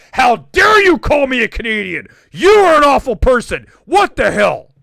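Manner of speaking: loud, hammed-up indignant shout; mock outrage. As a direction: yell it as if mortally insulted. Acting out an offended overreaction.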